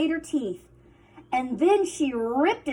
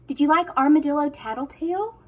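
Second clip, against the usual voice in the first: ordinary delivery, nothing marked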